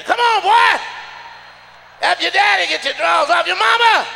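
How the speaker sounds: put-on high shouted call to the boy; calling out to the kid. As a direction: yell it up like a dad rallying his son. Voicing dad calling to kid.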